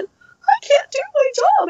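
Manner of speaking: very high, squeaky lament; mock whine. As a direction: wail it in a tiny, drawn-out voice. Anguished thought during the strike.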